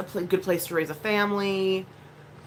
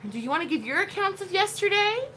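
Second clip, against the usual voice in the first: playful mom voice; 12 semitones higher than usual. Real, not acted. Asking her child playfully.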